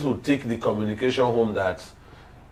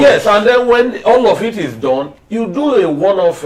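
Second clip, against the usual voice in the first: raised, much higher and louder, pushing the point; heated outburst; 10 semitones higher, 8 dB louder than usual. Real, not acted. Panelist overriding his co-hosts, heated and loud. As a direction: cut in hard over the others to win the argument.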